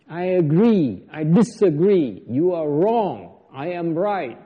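loud, insistent arguing voice; mock debater. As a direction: play the know-it-all debater, pressing hard. Monk mimics debaters arguing.

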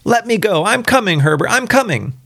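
high, urgent calling out; struggling wife's cry. A narrator's reading. Mother breaking free to reach Herbert, urgent high cry. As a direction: call to the son in a high voice. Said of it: "she cried, struggling".

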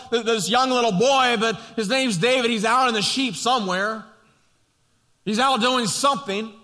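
full-force pulpit preaching; impassioned preaching. Acted. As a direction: preach it out to the congregation.

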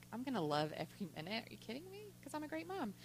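hushed, incredulous aside; retold disbelief. New mother doubts older lady's advice, softly incredulous. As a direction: toss it off very softly as imitated naive confidence.

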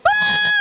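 loud, very high mock shriek; terrified scream. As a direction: scream like frightened young men, hammed up. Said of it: "Imagine twelve young men going".

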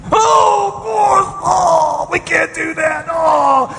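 high, breathless mock panic; hammed-up gasping alarm at the budget; hyperventilating panic. Pastor mocking his own panic at the budget meeting, high and breathless. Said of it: "I'm over there hyperventilating".

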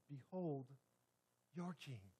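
soft, hushed proclamation; solemn presentation. Acted. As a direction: hush it, a grave unveiling. Repeated solemn refrain.

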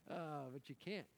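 usual speaking voice

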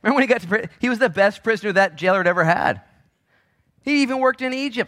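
loud, high sermon delivery; full-force preaching. Real, not acted.

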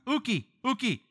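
high, playful repeated no; mock scolding mom. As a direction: chirp the refusal twice, high. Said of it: "can't accuse you of saying no".

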